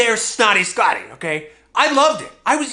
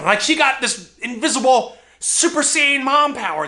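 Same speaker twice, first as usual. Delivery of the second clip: ordinary talk, nothing marked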